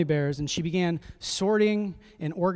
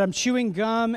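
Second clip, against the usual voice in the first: higher, louder guilty fib; dad's transparent lie, higher and louder; 6 semitones higher, 4 dB louder than usual. Retold or imitated. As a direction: oversell the lie, clearly caught out. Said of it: "she knows from my voice I'm probably lying".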